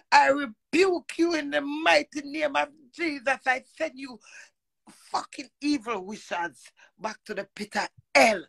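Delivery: high-pitched preacher's rebuke in the name of Jesus; rising, commanding church rebuke; fiery rebuke prayer. Retold or imitated. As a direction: cast them out like a pastor at full force.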